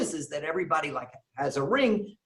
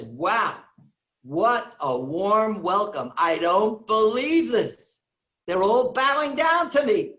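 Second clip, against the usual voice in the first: rising, slowed, louder marvelling; amazed delight; 5 semitones higher, 4 dB louder, slower than usual. Retold or imitated. Newcomer marvelling at the bowing congregation, amazed. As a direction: act out a man who can't believe his welcome.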